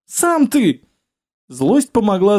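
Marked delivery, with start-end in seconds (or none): 0.1-0.8 high, sharp comeback; indignant retort. A narrator's reading. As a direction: snap back, offended.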